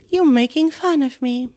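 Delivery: on the edge of tears; tearful accusation. Acted. Myrtle accusing Hermione, tearful. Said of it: "silver tears welling".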